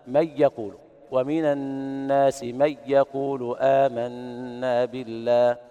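slow chanted reading with no nasalization on the merged ن; drawn-out Quranic chant, ن dropped into ي with no ghunna; recitation without ghunna. Shown as a demonstration. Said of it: "يترك الغنة عند الواو والياء".

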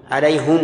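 "عليهم" read as ʕalayhumu, u after h and m; first reading. Shown as a demonstration. Teacher shows students the first reading of "عليهم". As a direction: say "عليهم" with damma on both ha and mim. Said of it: "الأول ... ضم الهاء والميم".

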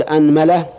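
أنملة as "anmala", a after hamza and mim; fatha on both. Shown as a demonstration. Teacher starting the nine forms, أنملة as "anmala". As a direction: say أنملة with all a vowels, anmala. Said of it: "افتح الهمزة".

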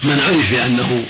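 usual speaking voice

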